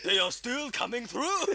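high, loud, slow hammed-up panic; mock movie line. Friend quoting a film, slow mock panic. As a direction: play the panicked officer.

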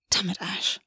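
soft unvoiced whisper; a muttered private whisper; whispered curse. A narrator's reading. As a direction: breathe the curse under your breath. August's inner curse.

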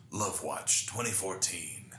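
slow, ominous mock news anchor; scare tactic news voice. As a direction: announce it like a dramatic news teaser. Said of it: "scare tactic voice".

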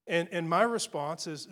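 ordinary delivery

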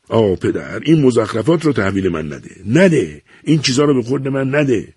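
explode, fed up with the nonsense; exasperated outburst. A narrator's reading. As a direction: son loses his temper at his father. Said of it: "که دیگر از کوره در رفته بود".

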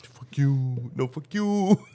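low, soft, sneaky retort; furtive mock cursing. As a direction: curse under cover, keeping it hidden. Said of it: "You hid your hand when you were like".

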